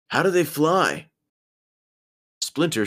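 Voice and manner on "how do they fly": wondering question. Acted. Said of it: "wondered Michelangelo".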